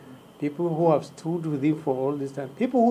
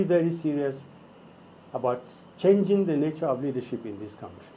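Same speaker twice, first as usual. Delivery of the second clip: ordinary talk, nothing marked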